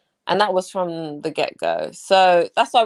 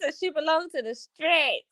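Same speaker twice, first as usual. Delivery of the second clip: mock whiny threat; 12 semitones higher, faster than usual. Imitating ex threatening on live.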